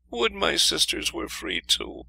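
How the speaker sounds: high, slow wistful voice; wistful princess. A narrator's reading. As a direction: sigh it out longingly. Princess wishes for sisters.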